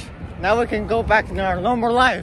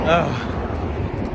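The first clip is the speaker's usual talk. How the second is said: ordinary talk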